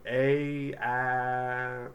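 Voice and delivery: "a" and "I" held long and slowly; trying vowel sounds. Shown as a demonstration. Teacher trying something different, held vowel sounds. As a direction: stretch each trial sound, "a", then "I". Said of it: "let me just try something different".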